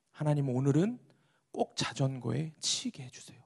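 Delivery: hushed plea, fading towards a whisper; quiet prayer. Acted. Pastor praying to be hit by a bicycle, hushed and prayerful. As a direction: repeat the prayer softly, in earnest. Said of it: "이렇게 기도를 하셨답니다".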